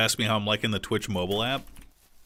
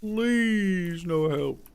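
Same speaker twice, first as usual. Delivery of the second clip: high pleading; 8 semitones higher, much slower than usual. Pretend. Begging again higher.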